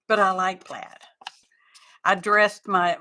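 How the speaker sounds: normal talking voice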